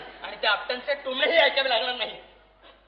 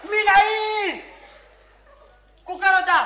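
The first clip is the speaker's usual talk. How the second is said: loud protest; 8 semitones higher, 8 dB louder, much slower than usual. Acted. Startled, blaming the cooker.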